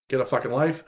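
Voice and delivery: normal talking voice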